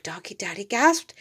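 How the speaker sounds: plain reading voice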